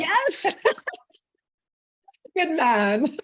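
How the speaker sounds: a real laugh running through the praise. Real, not acted. Host laughs at the husband story, laughing approval.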